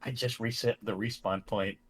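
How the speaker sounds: low, soft, slow murmur; hushed parody of an intimate confession; mock whisper. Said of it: "whispers in his ear".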